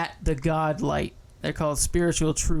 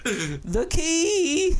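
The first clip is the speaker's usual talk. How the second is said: very high, drawn-out impression voice; Bill Cosby; 12 semitones higher than usual. Shown as a demonstration. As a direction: squeeze out a rusty, high-pitched celebrity impression. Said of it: "make me do Bill Cosby".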